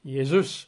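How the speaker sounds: Jesus said yay-ZOOS, as in Greek; Greek-style Jesus, stressed on the end; Greek pronunciation. Shown as a demonstration. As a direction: say Jesus with a Y sound for J. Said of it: "Or Jesus in Greek".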